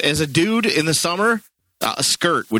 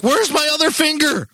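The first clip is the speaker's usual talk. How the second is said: high squeaky cartoon voice; 14 semitones higher than usual. Voicing confused Simpson.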